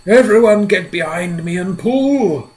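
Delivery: loud, high rallying call; rally them to haul; shouted order. Acted. Centipede rallying everyone to pull the rope, loud. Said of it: "shouted the old green centipede".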